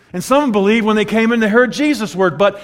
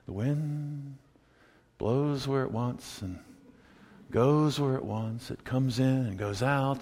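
soft, slow and low; quiet, lingering low delivery; hushed quoting; 8 semitones lower, 14 dB quieter, slower than usual. Acted. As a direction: draw it out quietly, like a mystery. Voicing Jesus describing the wind.

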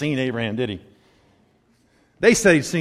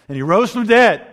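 raised, ringing declaration; imitating a preacher's climax; preacher's proclamation; 6 semitones higher, 7 dB louder than usual. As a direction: proclaim it loud to the room. Retold preacher proclaims the resurrection.

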